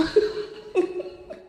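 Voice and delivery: high breathy burst of laughter; bashful laugh. Real, not acted. Woman laughing at her own dodge, bashful laugh. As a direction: laugh it off, embarrassed. Bashful about dismissing grief.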